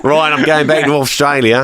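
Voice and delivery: mock Australian accent. Echoing the seller's line in a faulty Australian accent. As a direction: have a go at the Australian accent, slipping into Yorkshire.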